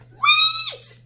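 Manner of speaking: high loud shout; desperate girl shouting wait; urgent cry. A narrator's reading. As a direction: stop him mid-leap. Sarah cries out.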